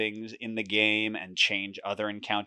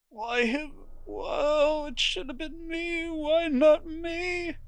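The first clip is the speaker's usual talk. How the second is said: high, strained voice of a grieving man; higher weeping lament, slow at the end; much slower than usual. Acted. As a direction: wail it as if your heart broke. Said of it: "a man weeping".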